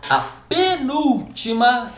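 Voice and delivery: penúltima stretched slowly and pitched high; emphatic stress. Shown as a demonstration. Teacher warning to count from the end, emphatic stress. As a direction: punch PENÚLTIMA, high and drawn out.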